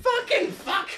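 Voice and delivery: frustrated yelp. Real, not acted. Bad roll reaction.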